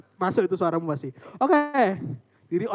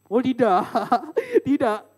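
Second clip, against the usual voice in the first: mock dread; 4 semitones higher, 5 dB louder, slower than usual. Refusing to sing, mock horror.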